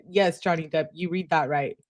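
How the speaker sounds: ordinary delivery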